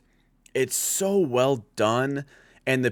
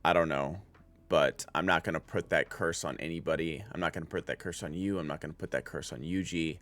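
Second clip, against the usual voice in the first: softly quoting Nanami; 6 semitones lower, 5 dB quieter than usual. Retold or imitated. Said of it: "he's asking, like".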